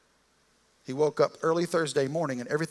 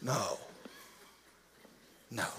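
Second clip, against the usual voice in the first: normal talking voice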